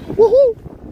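high, loud "woohoo"; whooping cheer. Real, not acted. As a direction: whoop in triumph. Celebrates having made it.

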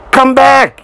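high-pitched urgent yell. A narrator's reading. Driver to Thomas, urgent yell. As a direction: yell after him, voice way up. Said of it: "yelled the driver".

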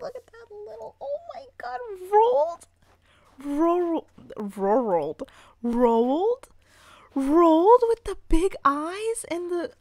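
high, loud gushing squeal. Real, not acted. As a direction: squeal over something adorable. Gushing over cute villager.

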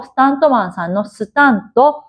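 ordinary delivery

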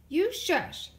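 short high loud snap; snapping. A narrator's reading. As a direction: cut him off sharply. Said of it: "snapped Sister".

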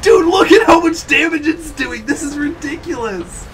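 high, loud amazed shout; excited shout. Real, not acted. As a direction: shout it in disbelief. Amazed at the damage.

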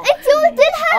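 high, loud, slow accusing whine; whiny outburst. Acted. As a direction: cry out the betrayal. Daughter upset mother told secret. (صرخة عتاب عالية)

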